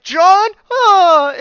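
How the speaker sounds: very high, loud mock shriek; shocked mom. Mom hearing her lost son before fainting, shocked shriek. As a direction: shriek the name, then cry out.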